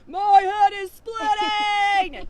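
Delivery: loud, slow groaning bull. Acted. Bull woken by the crow, loud slow boom. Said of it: "said the bull".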